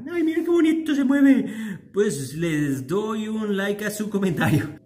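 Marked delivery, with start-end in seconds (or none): 0.1-1.4 high-pitched sudden delight; high, adoring exclamation; delighted cooing. Real, not acted. Breaks off to coo at bird.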